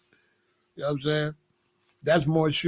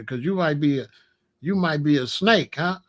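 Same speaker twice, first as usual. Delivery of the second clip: usual speaking voice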